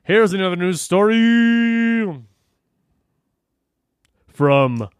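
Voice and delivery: slow, drawn-out news anchor intro; mock announcer. Co-host announcing the next news story in a slow, drawn-out anchor voice.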